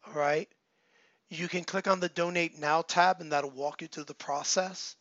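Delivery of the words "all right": usual speaking voice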